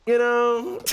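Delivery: laughing. Real, not acted. Cracking up at own joke.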